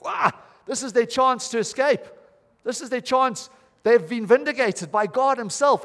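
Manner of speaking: excited thought. Retold or imitated. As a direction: sound like freedom is suddenly in reach. Imagined thought of the prisoners.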